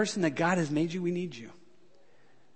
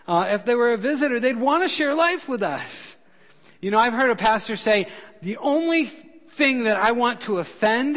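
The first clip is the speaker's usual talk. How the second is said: high, loud, slowing pulpit preaching; full-force preaching; 5 semitones higher, 6 dB louder than usual. Acted. Pastor preaching loudly to the church. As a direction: preach it out to the whole congregation.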